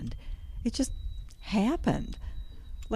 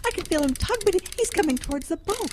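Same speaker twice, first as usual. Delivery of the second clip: excited strain; 9 semitones higher than usual. Acted. Fighting a fish near the boat, urgent and excited. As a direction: keep the urgency up.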